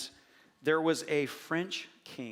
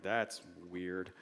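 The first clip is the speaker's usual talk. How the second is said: muttered aside; 9 semitones lower, 7 dB quieter than usual. Retold or imitated. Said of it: "I'm like".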